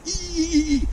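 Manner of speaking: rapid string of high, loud squeaks; high squeaky running noise. Retold or imitated. Recruit running across the wet deck in slides, high squeaks. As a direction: mimic careful running steps with squeaked "ee"s.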